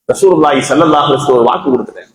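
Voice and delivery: ordinary talk, nothing marked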